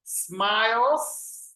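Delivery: smiles stretched out slowly with a rising tune; model for repetition. Shown as a demonstration. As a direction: draw out smiles for the class to echo. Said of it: "Let me hear you".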